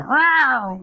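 high mock meow; cat meow. Speaker imitating his cat interrupting a client call. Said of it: "the cat at that moment would have to start to go".

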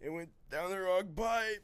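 strained voice after choking; choking on liquor. Real, not acted. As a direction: talk right after coughing on a shot.

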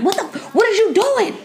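high-pitched, half-laughing outburst at the screen; mock mad yell. Viewer yelling at characters on TV, mock mad. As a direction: playfully yell at the TV, pitch shooting up. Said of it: "yelling at them".

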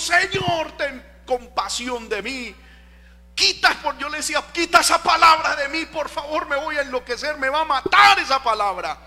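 high, loud pleading cry to the Lord; retold crying out. Pastor reliving his night of crying to God, high and loud. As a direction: relive begging God to lift the word. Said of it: "a los gritos".

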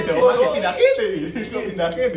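laughing. Real, not acted. Friends joking, laughing loudly.

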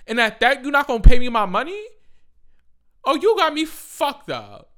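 loud, high, furious comeback; outraged worker telling off the boss; angry shouted retort. Acted. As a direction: yell it like you just got robbed of your pay.

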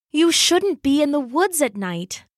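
ordinary delivery, nothing marked

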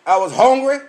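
normal talking voice

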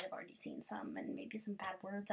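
soft secret whisper; hushed confession; whispered warning. Real, not acted. Warning aside.

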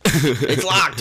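high, loud snap at the door; angry shout. Acted. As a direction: yell it at the knob jiggler. Angry reply to door jiggler.

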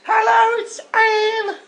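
loud, very high put-on voice; caller's mum. Dave's mum greeting the podcast, high put-on voice. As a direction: pipe up as a high-voiced mother character. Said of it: "It's Dave's mum".

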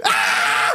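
short laughing comic scream; imitated scream. Imitating the friend cracking up, comic scream. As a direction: mimic a man screaming with laughter.

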